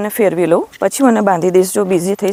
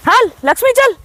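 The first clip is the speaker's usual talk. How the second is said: high-pitched herding call; calling an animal; 13 semitones higher than usual. Real, not acted. Woman calling her cow Lakshmi to come along, calling out.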